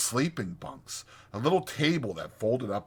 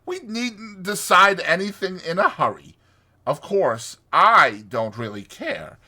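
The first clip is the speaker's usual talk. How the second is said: high, loud, airy persuasion; diplomatic; 8 semitones higher, 9 dB louder than usual. A narrator's reading. Toad winning over his two friends, diplomatic. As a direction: make it sound grandly unselfish, swelling at the end. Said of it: "he said diplomatically".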